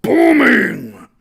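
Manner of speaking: booming burst on the word. Shown as a demonstration. Preacher showing the noise growing, a loud boom. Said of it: "the noise gets louder and louder".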